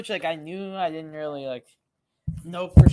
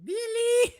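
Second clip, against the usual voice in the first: Greek mother calling. Retold or imitated. Echoing the Greek mother call.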